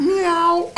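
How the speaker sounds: very high, thin cat meow; fake cat meow. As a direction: imitate a cat to cover the noise. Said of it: "It's a cat".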